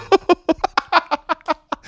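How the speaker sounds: high bursting laugh. Real, not acted. Laughing at a guest's comment.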